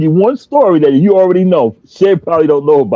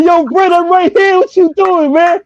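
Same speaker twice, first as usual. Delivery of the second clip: calling out; 13 semitones higher than usual. Retold or imitated. Calling out to friend from car.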